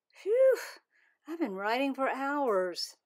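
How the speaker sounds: high loud phew; tired kid on the phone; exhausted phew. Acted. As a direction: blow it out like a worn-out writer. Tired after writing.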